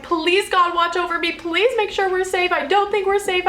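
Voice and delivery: high, frantic pleading prayer; screamed prayers. Retold or imitated. Mother in labor screams a frightened prayer to God. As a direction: cry out to God in fear. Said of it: "I'm screaming, like, prayers".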